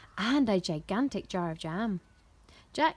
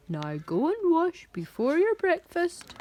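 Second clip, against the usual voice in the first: scolding giantess; 8 semitones higher, 4 dB louder than usual. A narrator's reading. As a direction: scold the giant in a big swooping voice. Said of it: "the giantess scolded".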